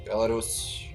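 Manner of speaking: ordinary delivery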